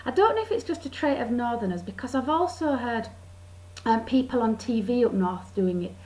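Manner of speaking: ordinary talk, nothing marked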